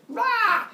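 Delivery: loud, high comic roar; mock retching roar. Kronos pukes out a little person, loud mock roar. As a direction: blast it out as one big heave. Acting out person being puked up.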